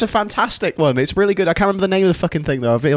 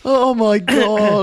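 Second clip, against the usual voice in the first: laughing; 4 semitones higher, much slower than usual. Real, not acted. Laughing at awful accent.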